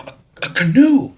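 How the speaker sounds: put-on high, loud stammering boy's cry; excited cry. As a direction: blurt it out, breathless with wonder. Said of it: "he cried".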